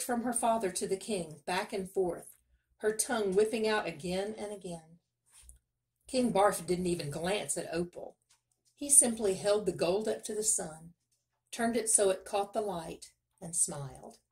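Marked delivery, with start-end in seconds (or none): none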